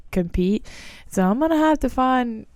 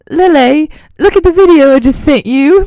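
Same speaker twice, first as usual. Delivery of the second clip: mock British accent; 7 semitones higher, 10 dB louder than usual. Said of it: "talking in this ugly British accent".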